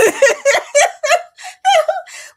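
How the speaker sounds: high burst of laughter; loud laugh. Real, not acted. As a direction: laugh hard at your own joke. Laughing after joking claim.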